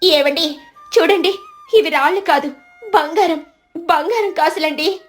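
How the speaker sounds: high, eager astonished wife; wide-eyed excitement. Acted. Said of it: "కళ్ళు పెద్దవి చేసి".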